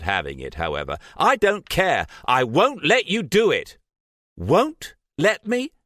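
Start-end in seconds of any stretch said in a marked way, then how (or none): 1.2-3.8 much higher, louder defiant shout; furious refusal. A narrator's reading. Trish refusing, enraged. 4.4-5.7 high, slow disbelieving challenge; defiant incredulity. A narrator's reading. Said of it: "defiant incredulity".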